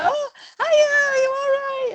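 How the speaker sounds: very high, sing-song chatty hello; a bright, high-pitched hello to old friends; chirpy greeting. Retold or imitated. Runner greeting friends at the checkpoint, high and chirpy. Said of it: "I was like".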